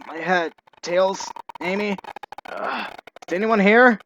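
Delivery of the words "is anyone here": loud call into emptiness. Acted. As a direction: call out, searching. Calling through the train.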